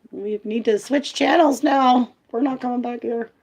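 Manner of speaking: exaggerated high voice of dismay; appalled viewer. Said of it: "she's, like, really lost her marbles".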